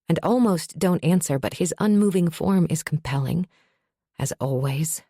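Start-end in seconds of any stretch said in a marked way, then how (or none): none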